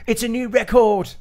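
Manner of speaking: high, loud mock Australian cheer; lightly voiced Australian hero shouting his triumph; mock Australian accent. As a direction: ham up the Australian accent line. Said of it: "clunkiest Australian accent lines".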